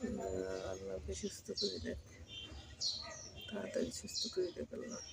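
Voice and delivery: hushed plea to God; whispered prayer. Real, not acted. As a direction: pray under your breath. Quiet prayer for the cat. (ফিসফিস করে দোয়া)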